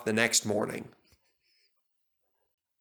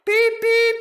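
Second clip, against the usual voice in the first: high, loud beeping whistle; whistle imitation; 8 dB louder than usual. A narrator's reading. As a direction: toot it like a happy engine. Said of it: "whistled Stepney".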